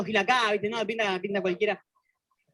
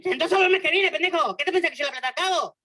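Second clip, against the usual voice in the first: higher and louder, scolding mom; angry mother; 8 semitones higher, 4 dB louder than usual. Retold or imitated. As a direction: snap at the kid like an annoyed mother. (madre retando al hijo, más aguda y fuerte)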